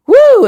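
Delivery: high, loud "woo"; loud whoop. Real, not acted. Vlogger reacting to her own blunt advice, loud high whoop. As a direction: let out a sudden high whoop. Exclaims while reacting to story.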